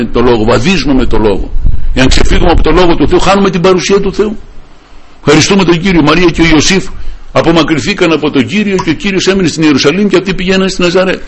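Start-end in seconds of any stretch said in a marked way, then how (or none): 1.9-11.2 higher, urgent preacher's voice; impassioned preaching. Acted. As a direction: preach it with rising fervour. Raised preaching about losing Jesus. (ένθερμο κήρυγμα με υψωμένη φωνή)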